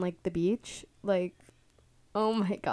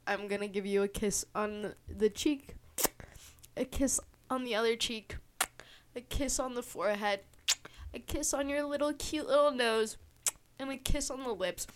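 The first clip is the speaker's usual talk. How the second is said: doting affection; 4 semitones higher than usual. Pretend. Vlogger handing listeners pretend kisses, doting coo. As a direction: coo it tenderly, a little higher.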